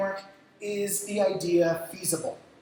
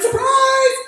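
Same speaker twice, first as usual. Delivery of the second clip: high, loud party yell; hammed-up surprise call; mock surprise shout; 12 dB louder than usual. Friends jump out at party.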